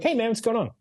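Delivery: high, light, friendly opener; breezy casual check-in; connecting question voice. Shown as a demonstration. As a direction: greet like a relaxed mate. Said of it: "connecting situation question voice".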